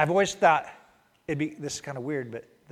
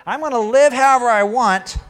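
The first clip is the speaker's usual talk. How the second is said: higher, louder smug voice; mock the deathbed plan; the procrastinating believer, smug and raised; careless sinner; 9 semitones higher, 12 dB louder than usual. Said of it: "to go".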